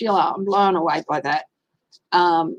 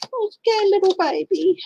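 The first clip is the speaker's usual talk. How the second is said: much higher, whimpering pity; mock pitying baby voice; 11 semitones higher than usual. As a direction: coo it like comforting a scared pup. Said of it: "always makes me go".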